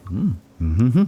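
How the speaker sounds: usual speaking voice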